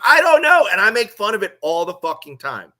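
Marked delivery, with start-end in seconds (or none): 0.1-0.7 high, loud helpless "I don't know"; loud retold answer. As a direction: blurt it out high and loud, at a loss. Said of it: "I'm like".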